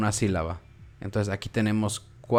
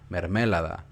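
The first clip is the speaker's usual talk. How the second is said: "mermelada" stressed oddly on the second syllable ME; misplaced stress; 2 semitones lower than usual. Shown as a demonstration. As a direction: hit ME in "mermelada", not LA. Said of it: "si le ponen más fuerza en otra sílaba". ("mermelada" con la fuerza en ME)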